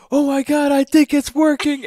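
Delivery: high, loud, thrilled mock voice of a fooled man; excited gullible guy. Retelling a Doritos ad, the fooled man's high thrilled squeal. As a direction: squeal it like he truly believes the box works.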